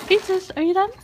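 high call to the dog. Real, not acted. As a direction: call out to the pet. Calling dog outside.

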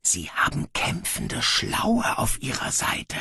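soft, slow whispered murmur. Acted. Legionaries whispering about the enemy, soft whisper. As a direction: hush it among the ranks. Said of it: "das Flüstern in den Reihen".

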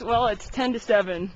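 ordinary delivery

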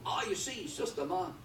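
objector's retort. Retold or imitated. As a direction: pipe up with a gotcha objection. Voicing an objector to his point.

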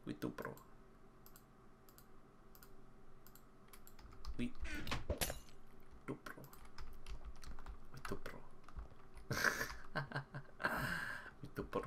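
very soft, almost to yourself; hushed catchphrase. Pretend. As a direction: say it under your breath. Repeats own catchphrase under breath.

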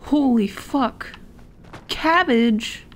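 slow, loud amazed exclamation; surprised. Real, not acted. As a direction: stretch it out in awe. Shocked at the find.

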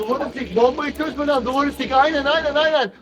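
high, loud frantic yelling; frantic shouting. Real, not acted. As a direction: yell it in a panic. Frantic instructions during defuse.

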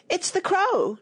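high, alarmed outcry; shrill alarm. Acted. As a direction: cry out a shrill warning in sudden fright. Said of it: "cried out in a shrill voice of alarm".

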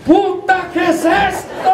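high, drawn-out cry of alarm; panicked cry. Acted. Rider shocked as the donkey takes off, panicked cry. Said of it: "se escuchó un grito".

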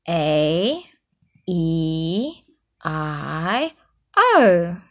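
snoring vowels. Acted. Reading goat's snores to children, low drawn-out.

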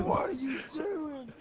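high, slow mock mom yell; mom's yell. Mom catches the boys, yelling at them. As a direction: shout up the stairs like an angry mom. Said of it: "And his mom yelled".

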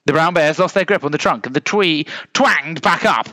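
plain reading voice

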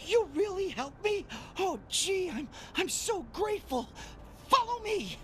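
high, jittery panicked little scientist; fretful imposter doctor pleading for help, fast and breathless; anxious little scientist. Acted. Imposter doctor begs the player for help, jittery and panicked. Jupy character voiced in game.

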